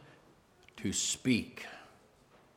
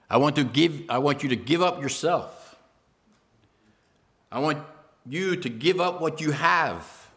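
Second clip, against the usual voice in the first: higher, louder, impassioned preaching; preaching at full force; 8 semitones higher, 7 dB louder than usual. Acted. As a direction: drive each demand home to the congregation. Preacher voices Jesus forcefully.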